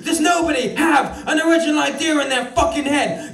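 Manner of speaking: an American executive blowing his top at the staff; furious American boss rant. Retold or imitated. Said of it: "says the angry man".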